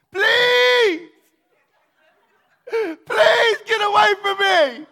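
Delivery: high, loud desperate scream; terrified screaming. Retold or imitated. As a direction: scream it for your life. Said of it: "screaming at the top of my lungs".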